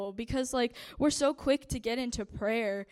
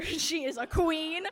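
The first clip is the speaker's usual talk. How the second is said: slow, tearful laugh on every word, louder; laughing through tears; 6 semitones higher, 4 dB louder, slower than usual. Real, not acted. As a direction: voice wobbling between a laugh and a cry. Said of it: "I'll try not to cry".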